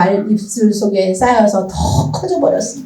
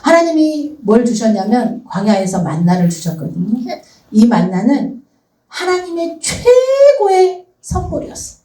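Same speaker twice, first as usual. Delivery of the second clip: ordinary talk, nothing marked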